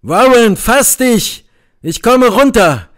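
high, loud shout. A narrator's reading. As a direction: call down urgently to a trapped friend. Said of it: "schrie sie zu ihm hinunter". (laut hinunterschreien)

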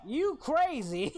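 slow, high teasing call; playful teasing. Acted. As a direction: drag out the tease. Teasing the YouTuber.

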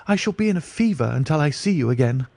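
mildly voiced anxious plea; eager cry. A narrator's reading. Diplomatist begging Holmes to return soon, eager. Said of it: "cried the diplomatist".

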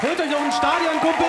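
high, loud stadium announcer voice. Pretend. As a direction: call it out like a stadium PA. Hyped stadium announcer joke.

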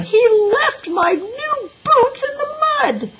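high, loud tearful complaint; wailing. A narrator's reading. Little girl complains to teacher about boots, wailing. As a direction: cry out the accusation, distraught.

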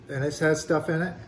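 blocked nose, nose pinched shut; wearing nose plugs. Real, not acted. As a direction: talk with nose plugged. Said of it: "Boy, it's hard to eat with your nose plugged".

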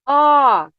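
А held long by itself; isolated vowel held. Shown as a demonstration. Teacher naming the last sound, А held alone. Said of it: "А, останній звук".

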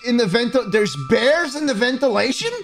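high, slow disbelieving question; can't believe what he just heard; incredulous. Real, not acted. Gamer mocking a confusing guide video.

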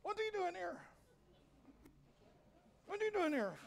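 high-pitched mock surprise; mock surprised people. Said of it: "people just start".

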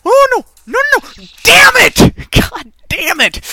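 high, loud gamer outburst; frustrated yelling. Real, not acted. Gamer cursing a failed move, frustrated yelling. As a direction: yell in real exasperation at a failed move.

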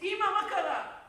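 loud, high startled question; jolted awake, call out in alarm; urgent shout to mother; alarmed son. Acted. Startled son springs up from sleep.

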